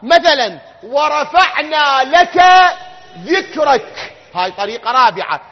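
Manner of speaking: fiery Friday sermon voice; preaching at full force. Acted. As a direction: drive each question home then answer it.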